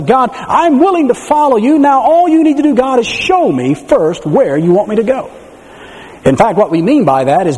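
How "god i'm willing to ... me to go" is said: higher, self-satisfied bargaining prayer; mock pious believer. Preacher mimicking a believer bargaining with God. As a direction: play the believer setting terms with God. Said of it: "most of us say".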